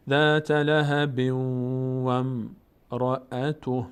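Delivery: slow, drawn-out tajwid recitation; Quran recitation. Shown as a demonstration. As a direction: chant each word slowly. Recites verse.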